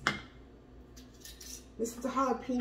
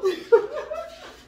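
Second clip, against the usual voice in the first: high laugh; 6 dB louder than usual. Real, not acted. Apologizes, laughs.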